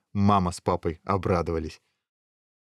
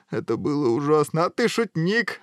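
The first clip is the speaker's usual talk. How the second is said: higher-pitched, climbing exclamation; exclaiming; 8 semitones higher than usual. A narrator's reading. Guard taking the prince for a joker, exclaiming. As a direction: exclaim in amused delight. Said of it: "воскликнул стражник".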